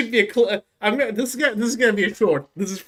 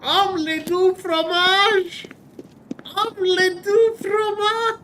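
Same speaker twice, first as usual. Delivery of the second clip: "Omelette du fromage" drawn out slowly in a much higher voice; silly high voice; 11 semitones higher than usual. Pretend. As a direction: squeak it out slowly, way up high, as a goofy joke. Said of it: "can I beat Skyrim by only saying omelette du fromage".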